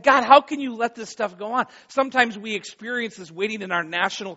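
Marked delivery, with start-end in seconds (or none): 0.0-1.7 loud, high cry to God; exasperated plea. Acted. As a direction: challenge God in raised-voice exasperation. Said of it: "Haven't you felt like that".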